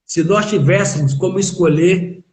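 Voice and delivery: ordinary talk, nothing marked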